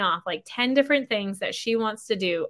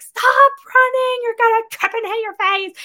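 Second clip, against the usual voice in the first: put-on yelling at kids; 14 semitones higher, 8 dB louder than usual. Mother yelling at toddlers running in dirt. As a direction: call out sharply to running kids.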